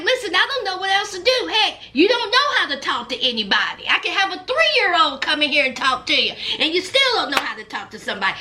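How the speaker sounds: rapid pleading rush; words tumbling out in frustration; fast exasperated Emily. Retold or imitated. Emily pleading with Jason, fast exasperated rush. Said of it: "Emily was like".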